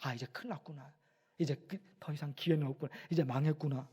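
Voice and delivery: very soft, low murmur of despair; hushed imitation of giving up; acted sinking heart. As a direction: let the voice drop as if all hope is gone. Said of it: "마음이 떨어져 버려요".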